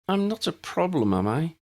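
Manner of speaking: put-on a young girl worried she is the problem; tiny voice. A narrator's reading. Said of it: "asked in a tiny voice".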